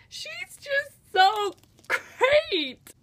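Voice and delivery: high, drawn-out fangirl squeal; gushing. Real, not acted. Gushing over favourite character.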